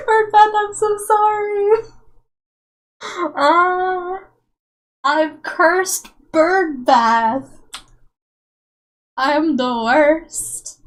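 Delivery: hammed-up teary sorry; mock wailing apology. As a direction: ham up the guilt like a sob. Mock apology to chatter Bird Bath.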